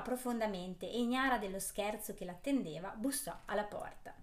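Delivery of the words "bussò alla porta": plain reading voice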